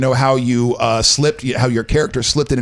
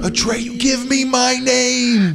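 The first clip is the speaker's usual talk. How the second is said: high, slow, drawn-out cry of the name; movie scream quote; 11 semitones higher, much slower than usual. Retold or imitated. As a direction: call it out long and high like into a storm. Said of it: "he screams it out the window".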